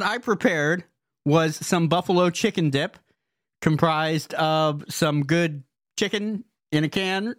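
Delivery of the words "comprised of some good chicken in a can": ordinary talk, nothing marked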